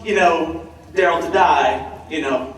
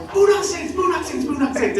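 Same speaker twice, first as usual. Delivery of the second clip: high, imitated buzz of fans repeating a name; excited bar chatter; 13 semitones higher than usual. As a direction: echo it like excited bar patrons. Imitates bar crowd repeating the title.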